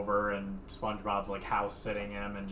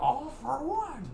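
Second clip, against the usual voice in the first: high cartoon voice; slower than usual. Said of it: "they're like".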